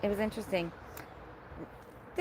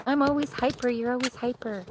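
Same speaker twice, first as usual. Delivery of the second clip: mock hyper; 4 semitones higher than usual. Real, not acted. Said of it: "says that I'm really hyper".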